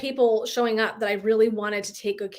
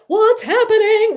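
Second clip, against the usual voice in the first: high, loud, slow panicked cry; mock-alarmed, much higher and louder; 12 semitones higher, 10 dB louder, slower than usual. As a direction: play the body's alarm, half-hearted panic. Acting out a panic attack.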